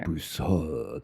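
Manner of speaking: Broussard with a French throaty ending; attempted French accent. Shown as a demonstration. Co-host tries her surname as the French say it, French accent. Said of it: "who said my last name with an accent".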